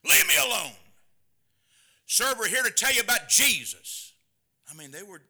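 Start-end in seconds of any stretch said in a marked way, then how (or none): 0.0-0.8 angry shout. Retold or imitated. Bouncer yells at visitors. 2.1-4.1 firm, loud, higher push back; pushy reply. Retold or imitated. Church men insisting loudly to the angry bouncer. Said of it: "kind of aggressive back".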